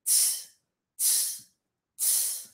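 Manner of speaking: three separate voiceless "tss" bursts; spaced, quiet hissed releases; English T hiss. Shown as a demonstration. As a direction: demonstrate the T as a soft cymbal hiss. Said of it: "Esse tss é o som do T em inglês".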